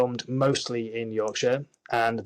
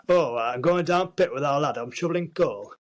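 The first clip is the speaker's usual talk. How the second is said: broad, higher-pitched mock Northern English; caricatured Northern accent; 4 semitones higher than usual. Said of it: "your accent is a caricature".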